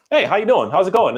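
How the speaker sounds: high, friendly hello to a dog; cheery greeting. Retold or imitated. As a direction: greet the dog like an old pal. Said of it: "we're walking in there and just".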